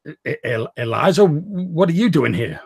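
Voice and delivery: stammering, rising in shock. Acted. Edgar startled to see his sister in the room, stammering in shock. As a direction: gasp her name as if seeing a ghost. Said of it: "he's like".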